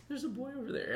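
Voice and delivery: high, soft little voice; small, high-pitched creepy imitation; imitated sleep-talker. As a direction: mimic a sleeper, hushed and high. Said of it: "You're like".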